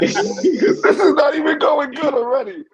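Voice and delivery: can barely get it out for laughing. Real, not acted. Laughing at the bad pitch.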